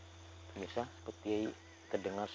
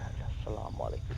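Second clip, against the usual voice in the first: whispered greeting; 11 semitones lower, 4 dB quieter than usual. Real, not acted. Greeting an unseen ghost.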